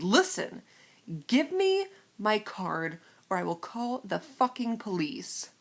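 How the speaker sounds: slow, loud, emphatic warning; measured, forceful retold threat; retold angry threat. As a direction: spell out an ultimatum word by word.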